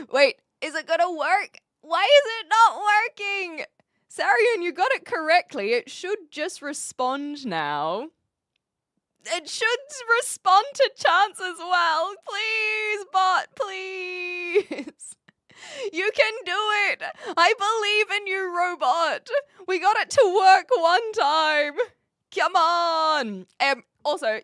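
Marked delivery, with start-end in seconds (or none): 0.1-14.9 high, whiny exasperation at a stubborn bot; mock pleading. Pleading with a failing chat bot. 15.9-23.5 high-pitched, whiny pep talk to a robot; mock desperate encouragement. Cheering on the failing bot.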